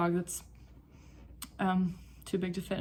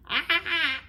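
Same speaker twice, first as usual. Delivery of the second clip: surprised high yelp; 10 semitones higher, 7 dB louder than usual. Real, not acted. Dog's mouth opens wide.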